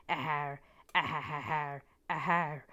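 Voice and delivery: hammed-up evil villain laugh; crazy villain laugh. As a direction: cackle like a cartoon baddie. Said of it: "Crazy villain laugh".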